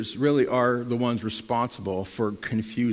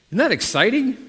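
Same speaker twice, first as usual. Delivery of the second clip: higher and louder, cheering the crowd on; excited prompt; 9 semitones higher, 5 dB louder than usual. Shown as a demonstration. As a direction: fire them up with the question. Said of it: "we'll try that one more time".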